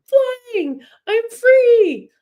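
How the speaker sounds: very high, loud joyful cry; gleeful acorn. Pretend. Little rebel acorn leaping from the tree, high squeal. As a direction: squeal it like a tiny acorn in free fall.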